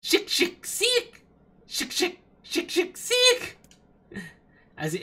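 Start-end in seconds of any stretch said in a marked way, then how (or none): 0.0-3.6 much higher, slow chirping squeak; insect chirp. Pretend. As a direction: squeak like a tiny bug. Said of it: "I'm an insect".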